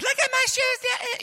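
put-on very high little-girl voice; a little girl showing strangers her shoes. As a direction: chirp it up like a proud five-year-old. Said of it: "She starts".

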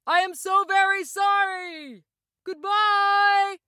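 high, drawn-out farewell call; calling from afar. A narrator's reading. Oz drifts away apologising, calling out high. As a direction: stretch the words out across the distance. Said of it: "called Oz from the basket".

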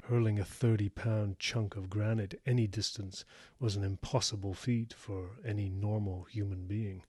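plain reading voice

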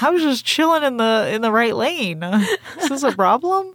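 high, hammed-up innocent pilot; mock leisurely pilot. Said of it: "She's just leisurely sailing across the galaxy".

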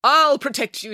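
high, resolute promise; firm declaration. A narrator's reading. Nanny Piggins reassures her frightened brother, firm declaration. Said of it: "declared Nanny Piggins".

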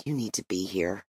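hushed, low and soft, still voiced; whispered. A narrator's reading. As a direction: murmur it low, as if to someone absent. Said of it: "she whispered".

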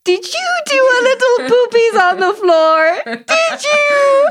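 very high, drawn-out cooing to a pet; ironic, sing-song pet voice, way up high; baby talk. Retold or imitated. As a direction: coo mock-sweetly at a naughty cat. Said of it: "baby talk to their dog".

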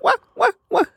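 put-on high piercing baby wail. Mimicking babies' accented cries, high wail. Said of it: "the babies are like".

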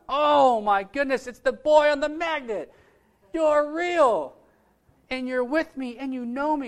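high, loud, awestruck exclaiming; overjoyed amazement. Retold or imitated. Sponsor holding the little boy from the magnet, overjoyed amazement. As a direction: burst out in delighted disbelief.

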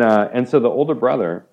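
usual speaking voice

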